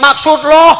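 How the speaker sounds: high, loud snippy comeback; sassy retort. Retold or imitated. Wife snaps back at angry husband, sassy high retort. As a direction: talk back with attitude.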